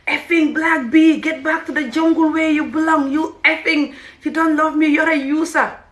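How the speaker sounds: imitating a drunk, abusive husband; retold drunken abuse. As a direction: spit out his insults in a put-on voice.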